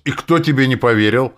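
high, loud indignant question. A narrator's reading. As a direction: demand it, clearly affronted. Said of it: "вдруг возмутился Гросс". (возмущённо, на повышенных тонах)

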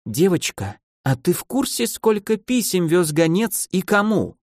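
menacingly sweet, high questioning; soft, ominous voice. A narrator's reading. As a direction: ask gently with trouble underneath, drawing out the end. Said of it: "Мягким голосом, не предвещающим ничего хорошего".

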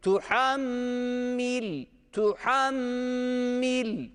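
"Tuhammil" very slow, the doubled m held long. Shown as a demonstration. Teacher shows students the shadda in "Tuhammil". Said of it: "Bu şeddeyi okumak zorundayız".

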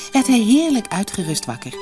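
plain reading voice

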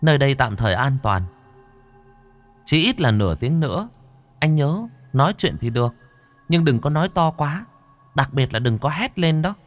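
high-pitched ghost character voice; ghost companion, cautioning. Acted. Linh quỷ warns Hùng not to yell, high cautioning voice. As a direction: caution him in a high, controlled voice.

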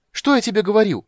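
high, loud triumphant cry; excited exclamation. Acted. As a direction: exclaim it over the wind. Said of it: "воскликнул он". (радостный возглас)

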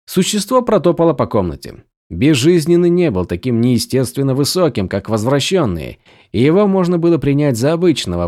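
ordinary delivery, nothing marked

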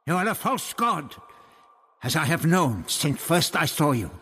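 high, scornful accusation of a false god; accusing priest. Acted. Old priest accusing Barbara of being a false god, scornful. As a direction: denounce her with contempt.

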